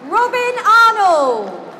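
ordinary talk, nothing marked

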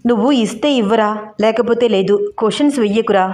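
usual speaking voice

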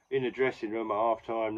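ordinary talk

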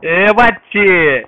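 stretched, loud call out; loud drawn-out call. Real, not acted. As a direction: call across to a teammate. Calling out to teammate in game.

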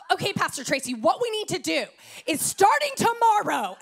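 rising into an urgent demand; pushy Californian. Retold or imitated. Californian newcomer pushing the pastor, rising urgent.

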